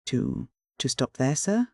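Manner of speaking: timid, halting question that lifts at the end; anxious boy. A narrator's reading. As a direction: stammer it out, afraid of the answer. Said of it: "asked Oliver anxiously".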